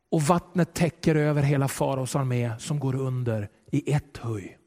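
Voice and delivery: ordinary talk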